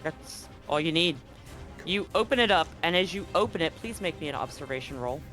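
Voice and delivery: normal talking voice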